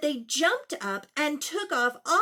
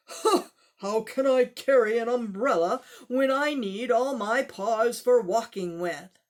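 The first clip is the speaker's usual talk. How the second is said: louder tiger voice, scoffing; 6 dB louder than usual. Acted. Tiger mocking the umbrella offer, loud and gruff.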